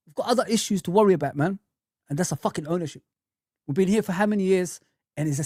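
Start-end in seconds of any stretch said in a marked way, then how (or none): none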